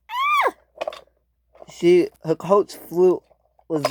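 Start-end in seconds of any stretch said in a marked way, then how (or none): 0.1-0.5 short very high squeal; thin little shriek way up high; scream. Acted. Rosie hit very hard, short squeal. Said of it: "she screamed".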